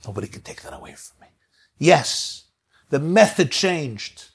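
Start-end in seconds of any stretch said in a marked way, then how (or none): 0.1-1.3 whispered, intense and low; quiet whispered conviction; hushed whisper. Acted. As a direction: breathe it out as a secret vow. Prisoner's defiant closing words.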